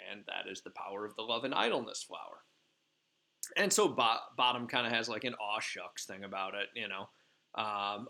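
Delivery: normal talking voice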